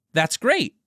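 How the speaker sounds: May delighted at the plan; excited exclaim. Acted. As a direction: burst out with delight. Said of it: "May exclaimed".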